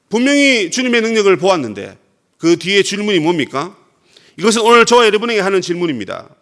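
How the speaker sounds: ordinary delivery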